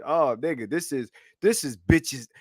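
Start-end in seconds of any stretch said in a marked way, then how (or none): none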